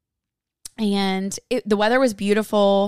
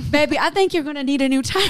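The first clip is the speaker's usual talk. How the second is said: higher, laughing tease; laughing mom; 5 semitones higher than usual. Retold or imitated. Mom laughing as she teases the biker about the tire. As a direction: tease him while cracking up.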